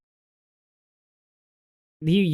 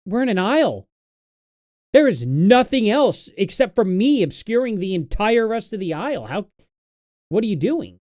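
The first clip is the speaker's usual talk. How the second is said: retold exasperation; 6 semitones higher, 4 dB louder than usual. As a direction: replay your fed-up rant in the aisle. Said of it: "And I'm like".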